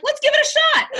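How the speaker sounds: put-on little boy game to try; hammed-up eager child; imitating son. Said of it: "He's like".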